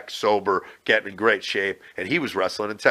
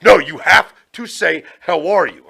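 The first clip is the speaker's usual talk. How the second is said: louder and higher, insisting on the script; mock the bosses pushing the line hard; insistent correction; 5 semitones higher, 6 dB louder than usual. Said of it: "They're like".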